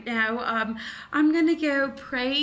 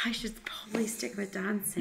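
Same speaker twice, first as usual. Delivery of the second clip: dejected whisper under her breath; whispered aside; 4 semitones lower, 9 dB quieter than usual. Acted. The dancer whispers sadly after being mocked. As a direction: mutter it to yourself, beaten.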